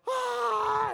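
put-on high delighted squeal of a little girl; excited squeal. Little sister squealing when told love you. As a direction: squeal like a thrilled five-year-old. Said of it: "She's like".

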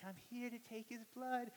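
high, soft, sweet little voice; nice phlebotomist. Retold or imitated. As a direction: imitate a gentle nurse announcing herself.

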